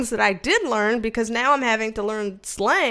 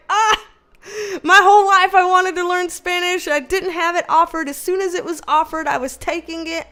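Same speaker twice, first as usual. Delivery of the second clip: high-pitched mock wail of frustration; exasperated outburst; 5 semitones higher than usual. Venting about wanting to learn Spanish her whole life, exasperated. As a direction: protest in a raised, aggrieved voice.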